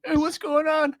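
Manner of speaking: high-pitched, confused call; puzzled victim in a high put-on voice; startled horror victim. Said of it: "He's like, he's like".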